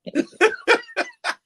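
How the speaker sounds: sudden high-pitched laugh; high burst of laughter. Real, not acted. Laughs at team owners.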